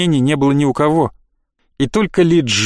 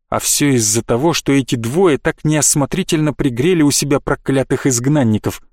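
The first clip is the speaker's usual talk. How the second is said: plain reading voice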